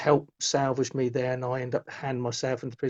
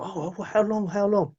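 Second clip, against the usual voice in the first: higher, insistent repeated question; eager questioning; 6 semitones higher than usual. Retold or imitated. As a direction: press for an answer, pitch raised. His urgent question to the medium.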